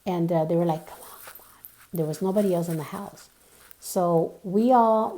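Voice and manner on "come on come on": urgent whisper, no voice; whispering to friends at night. Retold or imitated. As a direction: hurry them along in a whisper. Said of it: "trying to be, like I said, extra quiet".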